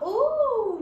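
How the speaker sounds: high, loud delighted ooh; storyteller showing excitement to kids, high and loud; excited ooh. Shown as a demonstration. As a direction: squeal it with glee. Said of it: "means this ice cream's excited".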